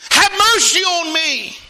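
loud, high cry for mercy. Acted. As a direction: shout the plea across a crowd. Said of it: "He began to cry out".

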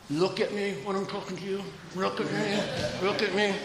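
soft, high-pitched mother voice; mother to child. Retold or imitated. Wife to her little girls, soft high-pitched insistence. Wife's words to their children.

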